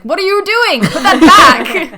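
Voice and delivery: high, very loud acted protest; mock outraged shout. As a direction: yell it as a hammed-up scolding, dragging the end. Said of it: "and then he's like".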